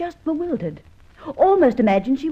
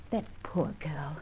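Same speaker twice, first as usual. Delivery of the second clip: whisper; 8 semitones lower, 12 dB quieter, slower than usual. Acted.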